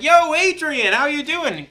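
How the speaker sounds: high, loud hyped hello; a coach's loud, high greeting across the field; coach calling out. Retold or imitated. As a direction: hail him like a pumped-up coach. Coach greeting him in passing.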